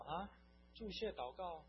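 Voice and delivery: re-enacted puzzled surprise, pitched high; mildly startled, high questioning echo; taken aback. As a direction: act out being caught off guard. His taken-aback reply, re-enacted.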